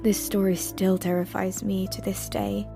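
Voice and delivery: ordinary delivery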